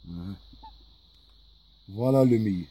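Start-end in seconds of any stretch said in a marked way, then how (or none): 1.9-2.7 loud teacherly call of the letter; announcing loudly. Real, not acted. Teacher announcing a letter to the class, loud.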